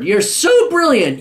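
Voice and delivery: high, drawn-out mock admiration; sarcastic praise. Real, not acted. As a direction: stretch it out with heavy sarcasm. Interrupts letter to jeer.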